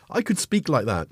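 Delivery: normal talking voice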